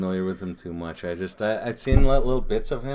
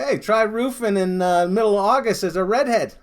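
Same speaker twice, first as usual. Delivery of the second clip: put-on higher, louder Jersey accent rant; Jersey accent Bill Burr; 11 semitones higher, 6 dB louder than usual. Retelling Bill Burr's roofing bit in Jersey accent. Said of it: "that Jersey accent".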